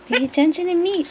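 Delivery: mock needy puppy voice. Owner voicing the puppy begging to be noticed. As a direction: beg for attention as the dog. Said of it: "his button's over here going".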